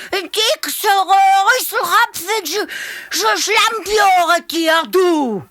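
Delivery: high, loud shouting insults; cheeky hare. A narrator's reading. Hare insults the mother elephant, high and loud. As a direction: taunt the big beast with shrill bravado.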